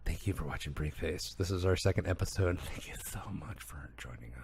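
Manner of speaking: low, hushed, breathy sultry whisper. Acted. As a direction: purr it close to the mic. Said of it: "sultry voice".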